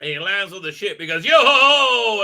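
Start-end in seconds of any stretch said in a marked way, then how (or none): 1.2-2.2 loud, high hammed-up yo-ho-ho; mock pirate cry. Retold villain landing on the ship, loud mock pirate cry. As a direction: bellow it like a pantomime pirate.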